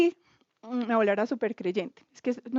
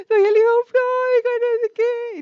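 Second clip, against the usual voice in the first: much higher, loud sobbing exclamation; tearful outcry; 11 semitones higher, 8 dB louder than usual. Retold or imitated. Woman recalling the night she wept cries out overwhelmed. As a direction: cry it out overwhelmed with emotion. Crying, nobody understood her.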